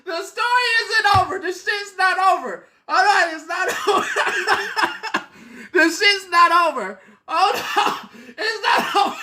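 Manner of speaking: high voice wobbling with laughter; barely holding it together; giggling it isn't over yet; laughing fit. Real, not acted. Laughing fit while insisting.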